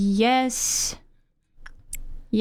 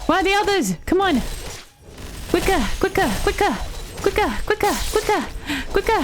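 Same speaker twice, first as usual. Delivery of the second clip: high, urgent cries of quicker; yelling at units to hurry; urgent shouting; 9 semitones higher, much faster than usual. Real, not acted. Gamer urging units to hurry, high and urgent.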